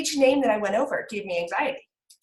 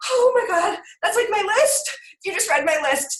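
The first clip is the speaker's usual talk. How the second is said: high, louder imagined exclamation; mock excited recognition; 9 semitones higher, 3 dB louder than usual. Listeners hearing their own list read out, mock amazement.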